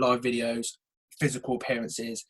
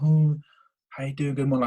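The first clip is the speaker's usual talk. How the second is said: bored greeting; 5 dB louder than usual. Shown as a demonstration. Coach imitating a worker who's been out late, bored. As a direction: answer as if you can't be bothered. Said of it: "it's bored".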